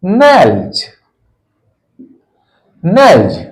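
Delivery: नेल्स said slowly, ending on a voiced z; teacher modelling नेल्स for the class; model pronunciation. Shown as a demonstration. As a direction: sound out नेल्स as nelz with a buzzing end. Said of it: "S जोर का plural बनाते हैं".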